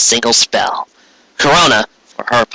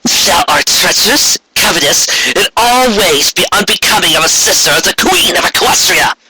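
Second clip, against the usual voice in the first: higher, formal proclamation of guilt; Corona proclaiming; 7 semitones higher than usual. Acted. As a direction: pass judgement on a sister. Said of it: "Corona proclaimed".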